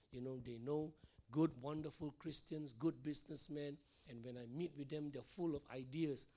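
ordinary delivery

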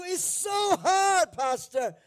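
imitating a member moaning to the pastor, exaggerated whine; mock whining complaint. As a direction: ham up a congregant whining it's too hard. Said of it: "When you ask someone to do".

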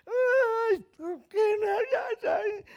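put-on high, slow cooing over a hurt child; hammed-up parent baby-talking a crying toddler; mock soothing parent. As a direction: coo it out like a fussing parent.

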